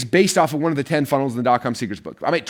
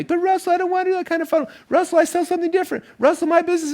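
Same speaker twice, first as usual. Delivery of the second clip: much higher, whining mock complaint; whiny student objection. Students fighting Russell's assignment, much higher mocking whine. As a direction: mimic a pestering student begging to be excused. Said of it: "some of you guys have been fighting me".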